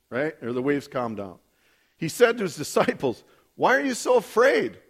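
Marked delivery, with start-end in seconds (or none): 3.6-4.8 raised, emphatic scripture quote; emphatic questioning. Acted. Jesus questioning his disciples after the storm, emphatic question. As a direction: press the question firmly, pitched up.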